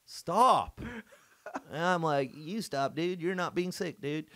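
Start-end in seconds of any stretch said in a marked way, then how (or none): 0.0-0.8 high, loud scolding shout; hammed-up yell to shut someone up; exasperated mock yell. Irish people back home bullying out the accent, exasperated shout. 2.3-4.2 slightly higher mock surfer dude; imitating a whiny American dude; put-on American accent. Returned emigrant answering back to Irish people, mock American accent. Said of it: "Stop saying dude".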